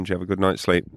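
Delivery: ordinary talk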